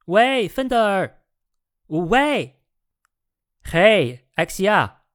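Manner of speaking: high, drawn-out calls; hey-you shout, pitched well up; hailing loudly. A narrator's reading. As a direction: hail a friend over the noise. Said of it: "他大声向他打招呼".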